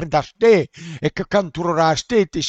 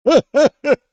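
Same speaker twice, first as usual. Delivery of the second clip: loud, high laugh; real laugh bursting out; sudden loud laugh; burst of laughter; 10 semitones higher, 7 dB louder than usual. Real, not acted. Laughing at the cook.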